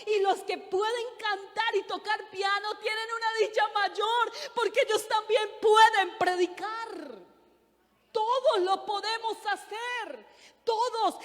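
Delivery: fervent pulpit build; preaching at full force. Acted. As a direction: drive it home to the congregation. Preacher builds to emphatic climax.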